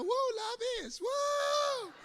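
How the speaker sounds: high shouted woo; high shouted cheer; mock hype. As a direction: whoop it like a hyped crowd. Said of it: "What happened to".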